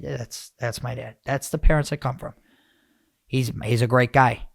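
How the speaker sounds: usual speaking voice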